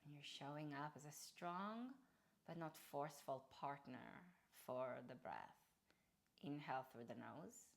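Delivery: soft, quiet meditation guide voice; guided breathwork. Acted. Yoga teacher softly guides students through Ujjayi breathing. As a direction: ease them through each breath gently.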